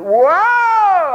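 loud, high comic whoa; a big-mouthed comic shout; Joey Brown holler. Retold or imitated. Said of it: "Talk about Joey Brown".

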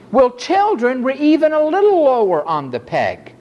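usual speaking voice

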